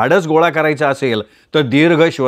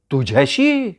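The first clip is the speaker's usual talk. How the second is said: a higher startled "you?"; surprised; 9 semitones higher, slower than usual. A narrator's reading. Nima as Paresh proposes to her, startled. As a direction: catch your breath in surprise. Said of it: "काहीशा आश्चर्याने निमाने त्याला विचारलं".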